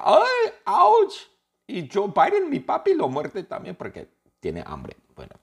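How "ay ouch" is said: shrill, very high, loud cry of pain; hammed-up howl of pain; [name] yelling. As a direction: yelp it like you've just been bitten. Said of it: "y [name] grita".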